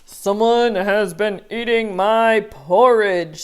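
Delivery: big bear growl of a voice; Papa Bear voice. Acted. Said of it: "said Papa Bear".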